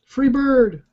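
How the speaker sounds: high called-out cheer; shouted request. Pretend. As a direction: call it out like a concert heckler. Calling out a song request.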